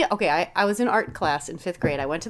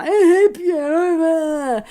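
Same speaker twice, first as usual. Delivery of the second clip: slow, drawn-out sulky groan from the back seat; sulking child dragging out every word; moody kid whine; 7 semitones higher, 6 dB louder, much slower than usual. Retold or imitated. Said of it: "I was being such a moody bitch".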